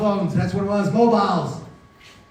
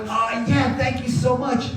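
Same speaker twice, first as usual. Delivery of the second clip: desperate gratitude; 7 semitones higher, slower than usual. Retold or imitated. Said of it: "But I'm desperate".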